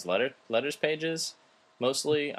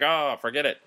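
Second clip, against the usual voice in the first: mock dismissive viewer voice; fickle viewers; 7 semitones higher, 5 dB louder than usual. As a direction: toss it off like a bored viewer. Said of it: "the people are like".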